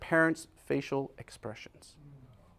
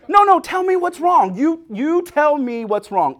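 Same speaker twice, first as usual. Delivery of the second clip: put-on loud, high, exasperated pleading with a baby; hammed-up demand, pitched high and loud; insistent demand; 12 semitones higher, 8 dB louder, slower than usual. Insistent second take to crying baby.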